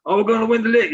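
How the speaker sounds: mock fan hype. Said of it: "it's like".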